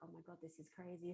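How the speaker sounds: ordinary delivery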